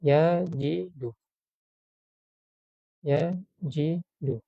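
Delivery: yajidu said very slowly, ya-ji-du. Shown as a demonstration. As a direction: drag out yajidu syllable by syllable for learners.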